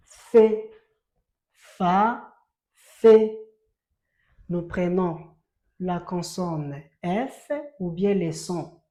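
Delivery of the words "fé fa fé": Fé and Fa called out loud; teacher drilling syllables aloud; loud model syllables. Shown as a demonstration. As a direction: drill Fa, Fé loudly for the class. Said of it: "ça donne Fé".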